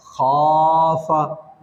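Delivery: खाफा stretched very slowly, long aa; drawn-out खाफा. Shown as a demonstration. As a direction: pull out the vowels of खाफा. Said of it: "ये खिंचाएगा". (खाफा को बहुत खींचकर)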